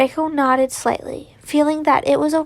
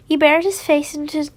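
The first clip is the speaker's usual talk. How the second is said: ordinary delivery, nothing marked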